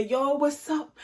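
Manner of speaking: high-pitched demo of a hype greeting; catchphrase greeting. Retold or imitated. Vlogger showing viewers the catchphrase, high, mildly hyped. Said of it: "So I just hit it with a".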